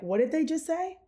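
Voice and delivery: high, rising incredulous question; mock double-take, pitched high; retold disbelief. Child reacting to two boys' insult, retold disbelief. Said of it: "I'm looking at them like".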